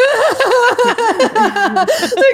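high, loud comic wail; mock bawling. Daughter breaking down on the phone to stepdad, hammed-up high bawl. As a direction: burst into exaggerated bawling. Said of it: "I just started fucking bawling my eyes out".

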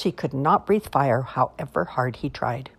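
usual speaking voice

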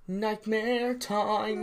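slow drawn-out spooky title; dramatic announcer. Pretend. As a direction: stretch it out like a horror trailer.

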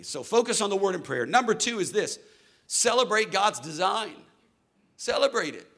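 loud, high preaching push; fired-up sermon delivery. Acted. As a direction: drive the point home to the congregation. Preaching at full force.

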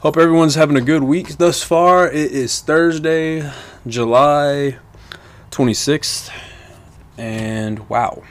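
normal talking voice

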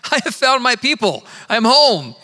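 high, loud retold cry of belonging; elated declaration. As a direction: proclaim it like a joyful discovery. Retelling joy at finding his people.

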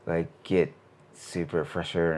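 ordinary delivery

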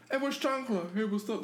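high, cheerful mock greeting; street greeting. People on the street greeting Chocolate, high and cheerful. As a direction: call out to him high and friendly.

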